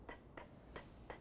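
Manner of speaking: whispered T, t, t, t taps; t sound. Shown as a demonstration. Teacher sounding out estuda for pupils, voiceless t taps. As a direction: tap a soft t with no voice. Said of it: "Som é t".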